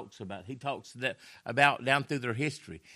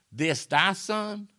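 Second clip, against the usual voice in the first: "thy" slow, high and scornful; stress on thy; 8 semitones higher, 6 dB louder, much slower than usual. Shown as a demonstration. Preacher repeating the brother's cold "thy", slow and scornful. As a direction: drag out "thy" with contempt in "This thy son". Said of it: "He didn't say".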